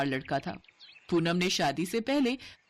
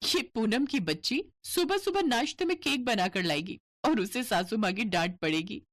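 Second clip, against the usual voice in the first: ordinary talk, nothing marked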